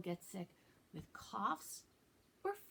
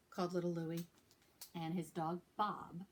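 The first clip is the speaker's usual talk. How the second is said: usual speaking voice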